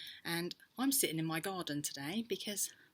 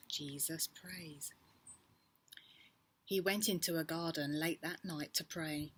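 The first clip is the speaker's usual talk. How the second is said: ordinary talk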